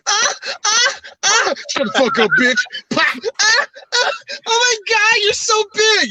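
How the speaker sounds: very high, squealing put-on moans; hammed-up ecstasy in falsetto; mock moaning. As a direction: fake breathless porn-star cries. Imitates overheard adult video audio.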